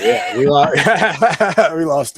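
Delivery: loud, breaking up with laughter; words lost in a laugh. Real, not acted. Host tells listeners they lost the co-host, laughing.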